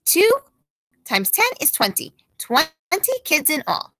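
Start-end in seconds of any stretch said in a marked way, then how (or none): none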